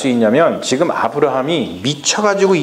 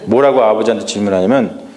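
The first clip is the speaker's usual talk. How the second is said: usual speaking voice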